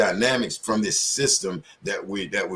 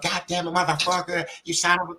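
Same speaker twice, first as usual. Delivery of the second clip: mock cussing; 7 semitones higher than usual. Shown as a demonstration. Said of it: "if you don't go in your job".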